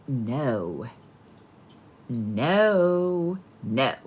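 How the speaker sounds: the universe turning you down gently; universe saying no. Pretend. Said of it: "the universe over and over has been like".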